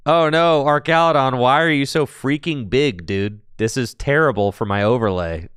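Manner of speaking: loud, high playful outcry; mock dismay. Host scolding a too-big card on the overlay, mock dismay. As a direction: wail at it in pretend exasperation.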